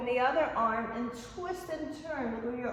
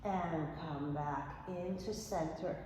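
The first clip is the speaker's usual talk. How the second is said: soft calm cueing; 5 semitones lower, 9 dB quieter than usual. Real, not acted. Cool-down stretch cue in class.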